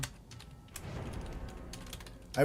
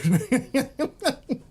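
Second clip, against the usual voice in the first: high burst of laughter; real giggling fit at own joke; cracking up; 13 semitones higher than usual. Real, not acted. Streamer amused by own accent imitation, high laughing.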